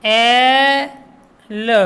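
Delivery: एल drawn out, final ल separate; sounded out. Shown as a demonstration. Teacher introducing the el ending, एल slowly segmented. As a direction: hold the vowel of एल, pause, then "luh". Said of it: "क्या लगा है?".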